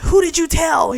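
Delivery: high, loud mock-outraged demand; hammed-up indignant outburst; going off on him. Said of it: "She goes off on him".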